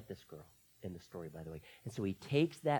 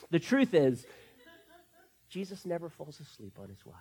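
usual speaking voice

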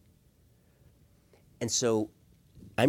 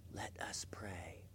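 near-whisper, slow and reverent; hushed invitation; 8 semitones lower, 20 dB quieter, slower than usual. Acted. Spouse inviting the other to pray, in a hush. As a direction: breathe it out almost without voice. Models the last magical words.

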